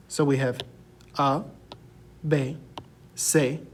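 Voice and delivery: usual speaking voice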